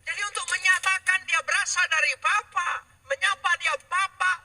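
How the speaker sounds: high, loud pulpit proclamation; forceful preaching. Real, not acted. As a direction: drive each phrase home to the congregation, stretching the last word. (khotbah lantang dengan nada tinggi)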